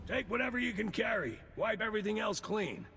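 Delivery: high, hurried commands; urgent orders. Acted. Agent urgently ordering his team to pack up and flee. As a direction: rush the team out.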